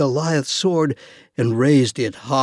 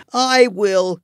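shouting; 7 semitones higher, 3 dB louder than usual. Acted. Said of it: "he shouted".

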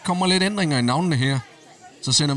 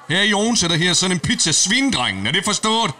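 threatening shout; 6 semitones higher, 3 dB louder than usual. Acted. Thug threatens pizzeria owner.

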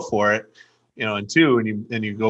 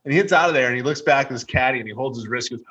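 ordinary talk

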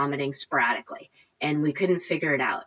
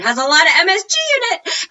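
high, fast mock-horrified squeal; mock horror; 12 semitones higher, 7 dB louder, much faster than usual. Real, not acted.

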